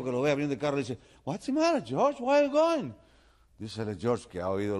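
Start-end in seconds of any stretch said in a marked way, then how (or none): 1.2-2.9 high-pitched alarmed calling; shouting. Acted. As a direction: holler after a departing friend. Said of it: "le empieza a gritar".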